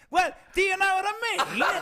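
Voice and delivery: put-on terrible English accent, high and loud; wildly overdone fake English accent; Jimmy Burke. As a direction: screech out a badly faked English accent. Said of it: "Jimmy had the worst English accent".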